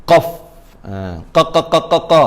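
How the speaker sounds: "qa" repeated from the very back of the tongue; deep tongue-root qaf. Shown as a demonstration. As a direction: drill a deep uvular "qa". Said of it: "pangkal lidah paling dalam". (sebut "qa" dari pangkal lidah)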